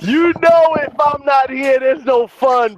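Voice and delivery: high, hammed-up bragging; playful boast. Host to his co-hosts, playfully boasting.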